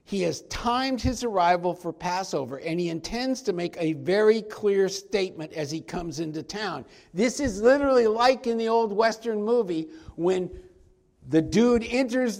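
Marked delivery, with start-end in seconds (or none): none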